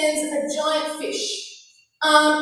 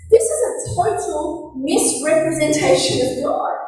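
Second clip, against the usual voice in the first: ordinary talk, nothing marked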